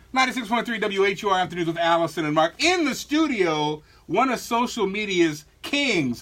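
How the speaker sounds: drawn-out, punched radio host introduction; on-air hype intro, slowing down for effect; radio announcer intro. Real, not acted. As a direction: announce the guest with big stretched-out words. Radio show intro read.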